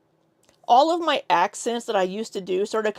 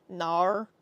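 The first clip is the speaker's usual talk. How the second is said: drawn-out Aussie vowel; mock Australian accent. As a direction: try one word in an Australian accent. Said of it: "thicker Australian accents".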